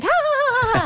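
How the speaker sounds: put-on high, gleeful toddler giggle; high happy toddler laughter from the back seat; toddler laughing. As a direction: giggle like a delighted little kid.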